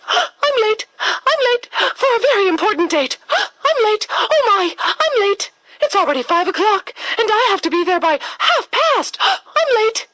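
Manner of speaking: high, fast, panicky little rabbit voice; a flustered rabbit gasping that he's late; squeaky high rabbit in a breathless rush. Acted.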